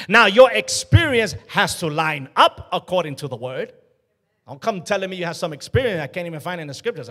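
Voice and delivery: ordinary talk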